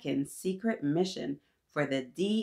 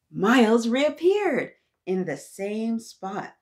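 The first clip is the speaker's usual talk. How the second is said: slow, louder announcement; dramatic reveal; 4 semitones higher, 6 dB louder, slower than usual. A narrator's reading. Narrator revealing Miles's return to kids, slow and louder. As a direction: land the big reappearance.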